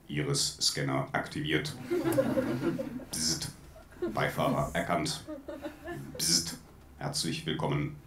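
bad robot voice, slow and monotone; lightly voiced robot, laughing through it. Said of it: "in meiner schlechtesten Roboterstimme".

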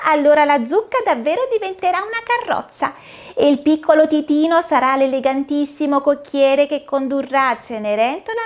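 a cheerful fairy godmother casting a spell; fata smemorina. Acted. Fairy voiced in story. (voce alta e allegra da fata madrina)

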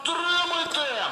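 high, drawn-out taunt; slow mocking battle cry; taunting throw. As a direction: hurl it at the enemy. Throwing a grenade.

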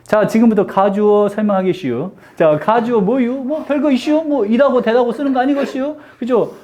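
comic Chungcheong-accented grammar lecture; Chungcheong dialect. Shown as a demonstration. Teacher explaining grammar as a test, playful Chungcheong dialect. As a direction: lilt each "-유" ending in Chungcheong dialect. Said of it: "충청도 버전으로 문법을 찍으면".

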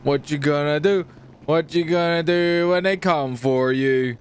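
ordinary delivery

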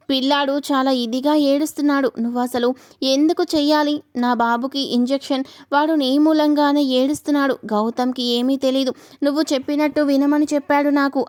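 ordinary delivery

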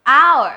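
HOUR loud as "ow-er", falling; silent H. Shown as a demonstration. Teacher giving students another try, silent H. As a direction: call out "hour" without the H. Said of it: "ลองอีกทีนึงค่ะ".

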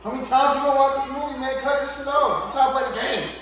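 high, loud, fast angry yell. Acted. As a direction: yell at him to shut it off. Acted quarrel about the movie.

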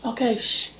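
soft hushed shh, breathy and unvoiced; quiet someone down without making noise; hushing someone nearby; shushing whisper. Real, not acted. Shushing someone while recording.

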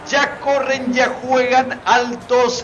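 excited play-by-play. Real, not acted. Match kicks off, live call.

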